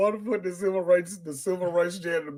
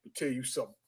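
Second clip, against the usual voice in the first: quiet threat; 6 semitones lower, 13 dB quieter, much faster than usual. Retold or imitated. Old janitor voiced, quiet.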